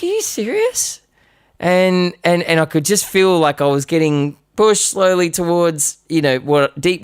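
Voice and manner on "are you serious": high squeaky outrage; stunned that dad sides against him; shocked disbelief. Retold or imitated. Teen asking his dad, high shocked squeak.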